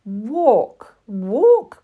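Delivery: high, loud chanting cries; loud high repeated call; chorus of dolls crying out. Acted. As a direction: shout it like eager little dolls. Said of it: "cried the other dolls".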